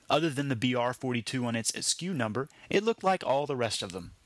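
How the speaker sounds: ordinary delivery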